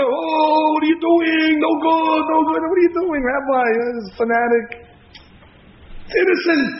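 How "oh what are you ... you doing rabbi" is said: retold screaming. Rabbi screaming at television, mock-shouted. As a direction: shout it like the old rabbi protesting. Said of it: "screaming bloody murder".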